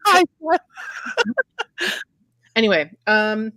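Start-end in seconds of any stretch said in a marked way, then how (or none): 0.1-0.6 squeaky mock greeting. Echoing the greeting, much higher. 0.6-2.0 high giggle. Real, not acted. Laughing at her own joke.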